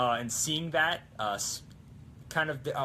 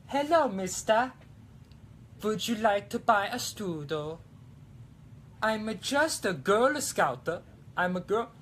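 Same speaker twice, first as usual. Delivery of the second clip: put-on high, slow, louder German girl voice; a hammed-up little German girl selling cookies; German girl scout; 8 semitones higher, 3 dB louder than usual. Selling to a man as a German girl scout, high and hammed-up. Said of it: "German girl".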